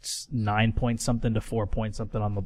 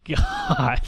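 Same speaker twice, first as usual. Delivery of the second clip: exasperated. Retold or imitated. Exasperated at toxic teammate.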